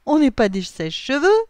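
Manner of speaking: high, loud, incredulous protest; exclaimed objection. A narrator's reading. William mocks Noah's drying idea, exclaimed objection. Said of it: "s'exclave William".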